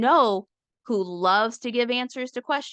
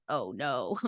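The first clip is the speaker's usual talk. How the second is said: low, soft mock dread; hammed-up quiet oh no; 9 semitones lower, 6 dB quieter than usual. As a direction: groan it like a worried opponent. Voicing scared opponent.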